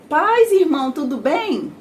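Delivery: bright, high cheerful hello to a church brother; cheerful greeting. Retold or imitated. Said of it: "eu era alegre". (saudação alegre e animada, mais aguda e mais alta)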